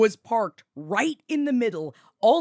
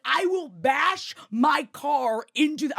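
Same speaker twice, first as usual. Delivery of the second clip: raised, slow re-enacted threat; screaming; 6 semitones higher than usual. Screamer threatening the badly parked car, slow and high. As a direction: draw out the angry threat, pitched up. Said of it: "As I'm screaming at the".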